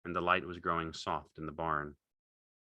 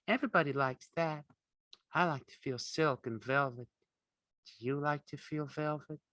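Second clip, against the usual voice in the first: put-on high coaxing young woman voice; Curly's wife; 8 semitones higher than usual. A narrator's reading. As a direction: coax him along lightly.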